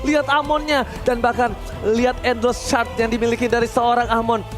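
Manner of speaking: ordinary talk, nothing marked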